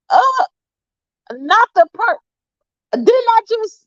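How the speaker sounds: very high, sputtering mock disbelief; incredulous protest. Granddaughter answering grandma's claim of being hurt, incredulous. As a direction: break off in high-pitched protest. Retold comeback to grandmother and daughter.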